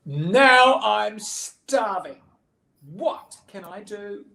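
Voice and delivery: hammed-up child's lament; starving despair. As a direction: wail it as if close to collapse. Betty despairs of hunger.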